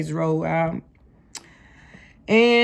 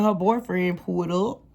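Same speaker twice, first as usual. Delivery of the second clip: usual speaking voice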